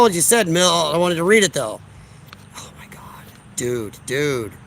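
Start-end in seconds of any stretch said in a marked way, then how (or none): 2.6-4.5 a shocked, hushed whisper. Real, not acted. Streamer reacting to a chat comment in a shocked whisper. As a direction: breathe it out so the mic barely hears.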